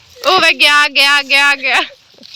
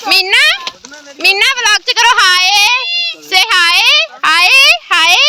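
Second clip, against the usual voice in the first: calling out; 5 semitones higher, 3 dB louder than usual. Real, not acted. Calling Meena to say hi.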